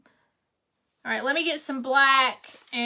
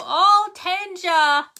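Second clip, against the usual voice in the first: slow, drawn-out, higher mock sigh of her friend; imitating Marie; 7 semitones higher, much slower than usual. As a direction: lightly voice the friend's fond sigh. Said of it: "You go".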